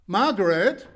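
loud, high call across the house. Acted. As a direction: call her from the next room. Butler calls wife from afar. (laut rufend)

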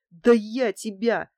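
loud, slow burst of a threat; the thug threatening the antique dealer, loudly; furious threat. Acted. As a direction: lunge with the words. Thug lunging at the old man.

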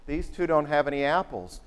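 ordinary talk

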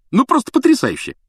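very high, loud gushing; hammed-up rapture; mock effusiveness. A narrator's reading. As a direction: overdo the delight, voice shooting up. Twins parody pompous greeting.

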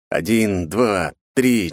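ordinary delivery

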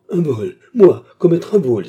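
indignant, rising to a loud, high outburst; outraged protest. A narrator's reading. Fernand denying the theft to the commissioner, outraged. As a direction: hurl back the accusation. Accused man protests innocence.

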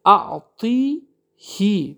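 اعطی هی drawn out very slowly with a final "hi"; very slow recited اعطی هی; example recited. Shown as a demonstration. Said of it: "بعد کسره آمده".

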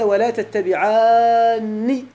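very slow, drawn-out Quranic recitation; teacher reciting the verse to the class, slow and solemn. Shown as a demonstration. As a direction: recite it as scripture, stretching each vowel. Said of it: "قال الله تعالى".

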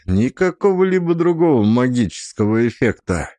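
ordinary delivery

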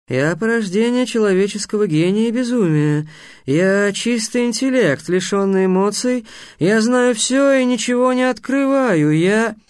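high, stretched, grandiose declamation; boastful sphinx. Acted.

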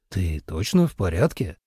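lightly voiced concerned man. A narrator's reading.

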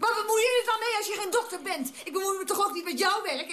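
high-pitched, quarrelsome protest; indignant retort. Acted. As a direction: throw the accusation back, voice rising. Acted quarrel about meddling.

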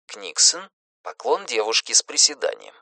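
usual speaking voice

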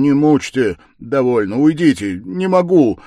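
exasperated, loud, high pleas; irritated. A narrator's reading. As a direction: burst out irritably, each phrase apart. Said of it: "раздражительно повторял он".

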